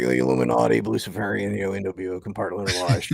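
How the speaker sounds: a hint of ranting conspiracy host; lightly voiced radio conspiracy ranter; Alex Jones impression. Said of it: "Not a bad impression".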